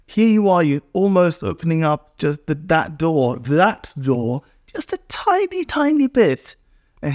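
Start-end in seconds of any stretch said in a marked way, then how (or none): 4.7-6.6 very high, squeezed-small delivery; tiny high voice. Shown as a demonstration. Showing how little the door opens, tiny high voice. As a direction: pitch it way up to show something minuscule.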